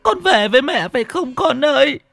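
high sobbing calls for her lost son; wailing mother. Acted. Mother calling her dead son's ghost, wailing. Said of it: "miệng mếu máo".

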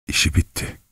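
soft, hushed whisper. A narrator's reading. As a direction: breathe it out, barely audible. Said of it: "diye fısıldadı Katerina Lvovna".

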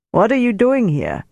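a lightly voiced Frankfurt German sergeant, challenging; Frankfurt accent. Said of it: "he said in a Frankfurt accent".

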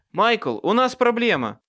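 loud, high-pitched warning call; urgent shout. A narrator's reading. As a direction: shout it across to your friend. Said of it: "крикнул я". (громко и тревожно крича)

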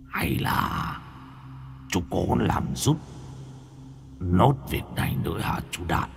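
low, soft, menacing ghost; spiteful ghost voice. A narrator's reading. Ghost brother menacingly asks younger brother another favour, low and spiteful. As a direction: hiss it from behind him, sinister. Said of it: "giọng nói nghe có vẻ hằn học lắm".

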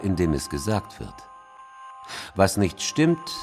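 ordinary talk

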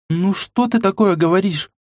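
high, indignant exclamation; indignant girl. A narrator's reading. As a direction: protest like an offended girl. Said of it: "возмутившись, воскликнула она". (возмущённый девичий голос)

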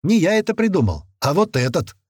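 voiced young gunman. A narrator's reading. Gunman addresses the watching fighters.

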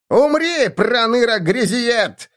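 high, slow shrieking halfling yell; halfling battle cry. Acted. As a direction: scream it as a tiny brute attacks. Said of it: "крикнул полурослик".

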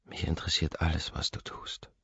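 trailing off into a breathy whisper; intimate whisper. Acted. Anna whispers her devotion to him at the piano, hushed. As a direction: whisper it close to his ear.

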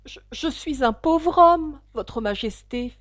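high, slow, stammering plea; trembling voice. A narrator's reading. The poor hatter pleads to the king, trembling. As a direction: quaver like a frightened subject. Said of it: "d'une voix tremblante".

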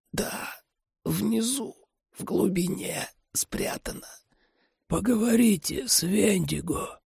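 very soft, high, broken phrases; dying whisper. A narrator's reading. Dying man revealing what is hidden, faint and laboured. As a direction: gasp out the secret with little breath.

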